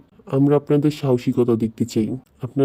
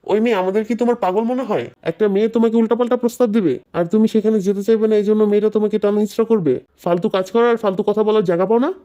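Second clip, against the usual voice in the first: raised-pitch angry telling-off; indignant scolding; 5 semitones higher than usual. Acted. Bystander disbelieving accused man, scolding. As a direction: rebuke in high, unbroken indignation.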